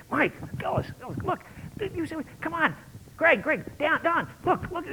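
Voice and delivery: high, hushed urgent calls to the crowd; urgent agitator. Retold or imitated. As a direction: work the crowd in a high, pressing hiss.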